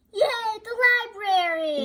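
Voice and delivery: loud, high cheer; excited exclamation. Shown as a demonstration. Pupil showing teacher what an exclamation mark means, excited shout. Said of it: "you say it out loud".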